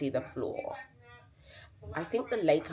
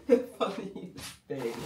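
words through a laugh; 7 semitones higher, 4 dB louder than usual. Real, not acted. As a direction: giggle it out. Laughing at a gift.

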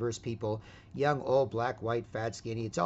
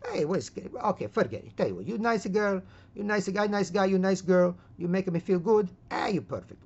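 put-on raised, accented comic voice; placating old man; 8 semitones higher, 5 dB louder than usual. Old man flatters his wife to end it, hammed-up accent. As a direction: humor her as a hammed-up old husband.

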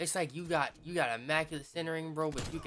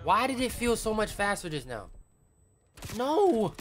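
high, loud outburst ending in a cry; frustrated yell; 9 semitones higher, 11 dB louder than usual. Real, not acted. Gamer raging that the aim felt faster, loud high yell. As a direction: blow up at the game.

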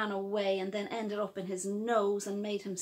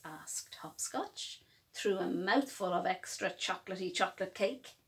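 ordinary delivery

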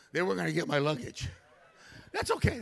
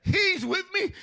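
calling out; 12 semitones higher, 8 dB louder, slower than usual. Retold or imitated. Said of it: "Nicky turns around".

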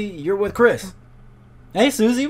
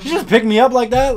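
higher, louder disbelief; shocked; 8 semitones higher, 7 dB louder than usual. Real, not acted. Player stunned Susie lifted him one-handed, higher and louder. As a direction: sound stunned by what just happened.

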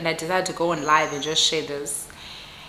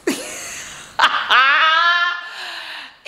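high, giggling burst of laughter; 8 semitones higher than usual. Real, not acted. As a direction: laugh out at the absurdity. Laughing at the absurd eggs.

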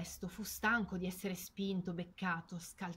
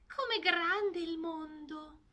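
high, slow, wide-eyed little voice; amazed duckling; 8 semitones higher, 4 dB louder, much slower than usual. Acted. Ducklings discovering how big the world is, amazed. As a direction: marvel like a tiny chick. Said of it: "I piccoli cinguettarono".

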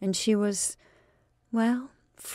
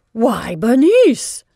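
loud, slow shocked cry of a name; aunt shocked to see her niece's bobbed hair, crying out loudly and slowly; 4 semitones higher, 11 dB louder, much slower than usual. Acted. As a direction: gasp out her name in horror.

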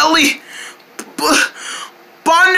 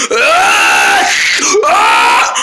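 scream; 3 dB louder than usual. Acted. Child screaming.

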